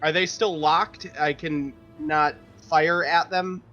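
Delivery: usual speaking voice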